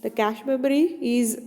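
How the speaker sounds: ordinary delivery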